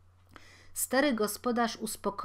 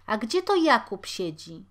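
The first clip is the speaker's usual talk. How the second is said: loud question; 3 semitones higher, 8 dB louder than usual. Acted. Woman asking for Jakub.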